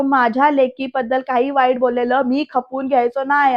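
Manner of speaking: usual speaking voice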